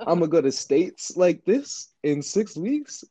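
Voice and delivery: high incredulous mock question; mocking disbelief. Mocking the one-rep plan.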